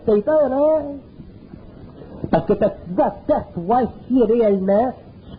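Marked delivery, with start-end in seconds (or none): 0.0-0.8 needling tease rising at the end; mock the listener's fear, high on the last word; taunting little voice. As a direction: inner voice taunting you. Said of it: "elle dit".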